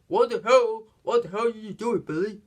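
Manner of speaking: retold cop shouting What the hell; angry yell. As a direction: yell it like a fed-up cop who caught his partner slipping. Cop yelling at Billy.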